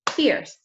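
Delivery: fierce said crisply as one clapped beat; one clear beat on fierce, like a clap; clapped syllable. Shown as a demonstration. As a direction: model the single syllable of fierce. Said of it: "Let's clap the syllable".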